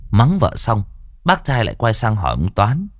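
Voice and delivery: ordinary delivery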